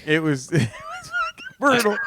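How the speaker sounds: words broken up by laughter; real laughter, high and strained; laughing. Real, not acted. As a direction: laugh your way through it. Laughing while telling.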